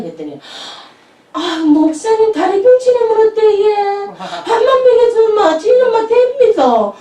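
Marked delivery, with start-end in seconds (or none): 1.3-6.9 high sing-song, half crying; a hammed-up eager bride-to-be; eager sing-song woman. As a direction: gush that anything goes if she gets fed. Daegu woman quoted eagerly.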